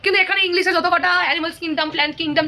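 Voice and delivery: ordinary talk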